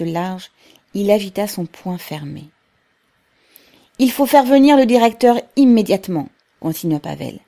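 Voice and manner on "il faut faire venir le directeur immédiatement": high, loud declaration; firm public demand. A narrator's reading. Pavel tells the workers to fetch the director, loud and insistent. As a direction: demand action before a crowd.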